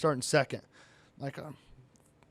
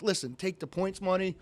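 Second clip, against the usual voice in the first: softer, retold plea; pleading; 3 semitones higher, 6 dB quieter than usual. Suspended driver bargains with the official to race, pleading. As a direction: bargain gently, imitating your own plea. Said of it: "So I'm pleading with him".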